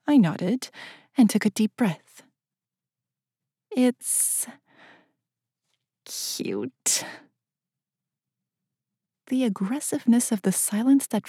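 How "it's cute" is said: breathy half-whispered confession; hesitant hushed admission. A narrator's reading. Narrator confesses to Anatoly his office is cute, hushed. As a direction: ease the word out, almost unvoiced.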